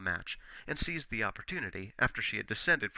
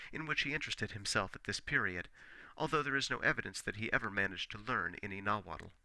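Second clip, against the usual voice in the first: ordinary delivery, nothing marked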